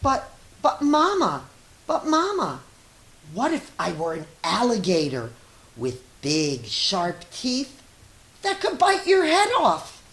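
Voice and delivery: put-on high, loud little-child voice, eager and wondering; pleading child. A child in bed asking Mama a big question, high and eager. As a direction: squeak it up as an excited small child.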